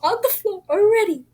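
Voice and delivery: panicked. Retold or imitated. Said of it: "And I was like".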